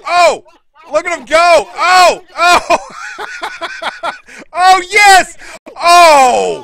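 very loud, high, thrilled shouts; excited yelling. Real, not acted. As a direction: go wild calling the live action. Commentator erupts at the action.

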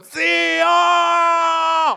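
booming distant shout. Acted. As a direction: yell it from the mountaintop. Man on mountain shouts.

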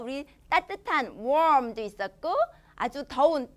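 ordinary talk, nothing marked

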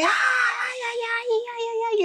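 high repeated imitated ay-ay cries; mock wailing. Old lady faking a fall, wailing high for attention. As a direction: ham up a fake injured wail. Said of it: "y empezó a gritar".

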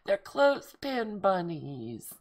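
loud laugh, then slow high words; delighted laugh. Real, not acted. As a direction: laugh out loud at the clever bunnies. Laughing at clever gift.

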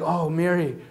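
hushed, low and hesitant; soft, low confiding voice; secretive. Acted. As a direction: murmur it like a shy secret. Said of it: "that it cannot be hidden".

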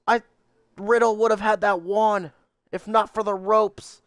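ordinary talk, nothing marked

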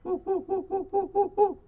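chimpanzee hoots. Acted. Teacher reading to kids, hooting high like a chimpanzee. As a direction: hoot like an excited chimp. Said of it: "says the chimpanzee".

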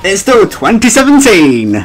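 "twenty seventeen" pushed up and out; emphatic correction. Real, not acted. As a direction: correct yourself, leaning hard on "seventeen". Correcting own year mistake loudly.

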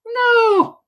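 very high, drawn-out no; mock disbelief. Streamer answers own question on moaners, mock high-pitched no. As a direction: squeal it up high in pretend shock.

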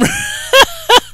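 sudden high laugh bursting out; burst of laughter. Real, not acted. Laughing at radio banter.

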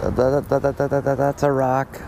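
quick, rising stammer of surprise; excited stammer. Real, not acted. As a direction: blurt it out, tripping over the word. Angler stammering at sudden snag.

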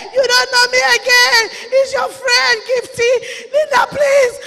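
high, loud, urgent cry; desperate pleading. Acted. Dead friend Gifty begs Linda, desperate loud plea. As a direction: plead at full voice to be recognized.

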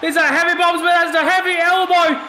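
high, shouted hype commentary; worked-up wrestling colour commentator; excited ringside call. Pretend. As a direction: shout it like the big blows are landing. Calling heavy blows as play-by-play.

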